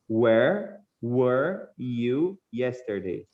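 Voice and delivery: very slow, every word set apart; walk the student through it slowly; teacher modelling the question slowly; slow model reading. Shown as a demonstration. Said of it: "dá pra ver bem a diferença das duas pronúncias".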